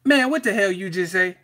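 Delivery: high, loud Chris Tucker bark; Chris Tucker impression. Reacting to a YouTuber's video, loud and high Chris Tucker voice. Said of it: "I feel like Chris Tucker in Rush Hour".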